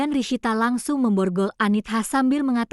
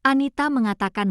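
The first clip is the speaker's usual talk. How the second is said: ordinary delivery, nothing marked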